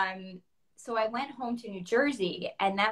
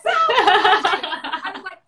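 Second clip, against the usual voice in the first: much higher and louder, laughing as she talks; laughing through words; 9 semitones higher, 10 dB louder, much slower than usual. Real, not acted. Guest laughing at her own Mozart logic. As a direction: laugh through the line at yourself.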